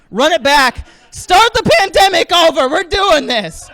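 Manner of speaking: rally the crowd like a fool; mock rallying cry.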